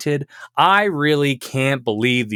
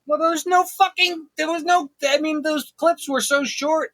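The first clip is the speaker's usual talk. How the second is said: higher whining boss complaint; whiny tantrum; 13 semitones higher than usual. Acted. Boss whining to his cohost about a hard game. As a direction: sulk and whine like a petty boss.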